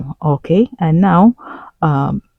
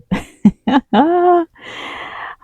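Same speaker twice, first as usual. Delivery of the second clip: long, high-pitched laugh; 13 semitones higher than usual. Real, not acted. Vlogger reacting to the reunion drama, laughing high.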